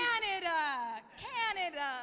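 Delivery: loud drawn-out cry of Canada; loud chant of Canada; shouted Canada. Acted. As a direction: call it out big.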